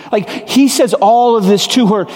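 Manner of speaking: usual speaking voice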